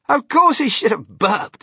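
loud, high exclamation; Mister Wonka. A narrator's reading. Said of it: "said Mister Wonka".

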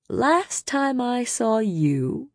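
high, slow, drawn-out sneer. A narrator's reading. Jurian to his old enemy Rhysand, sneering. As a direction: stretch it out as a contemptuous jab. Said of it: "Jurian sneered".

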